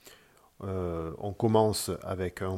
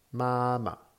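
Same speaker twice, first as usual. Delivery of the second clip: MAMA with high held first syllable, unaccented second; "Mama" high and level, then a light unstressed ending; neutral tone demo; 4 semitones higher than usual. Shown as a demonstration. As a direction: hold the first "ma", drop the last lightly. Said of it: "c'est le ton neutre".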